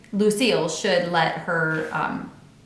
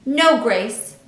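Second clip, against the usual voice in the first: put-on cross girl; 4 semitones higher, 7 dB louder than usual. A narrator's reading. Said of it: "She crossed her arms".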